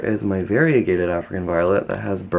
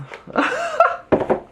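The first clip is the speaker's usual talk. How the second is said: laugh. Real, not acted. Laughs at own slip.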